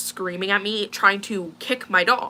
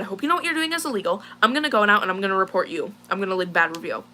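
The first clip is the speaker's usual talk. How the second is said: retold lost temper; faster than usual. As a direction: blurt it out heatedly, re-enacting an argument. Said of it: "I just lost it".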